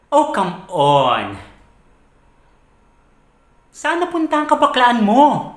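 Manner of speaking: loud incredulous protest leaping high; exasperated outburst. Acted. Arguing with partner over breakup excuse, exasperated.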